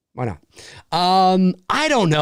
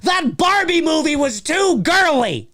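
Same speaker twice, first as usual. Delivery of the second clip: mocking whiny critic; 8 semitones higher than usual. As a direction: parody an angry fanboy.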